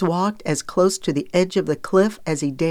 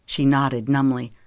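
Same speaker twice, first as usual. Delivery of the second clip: plain reading voice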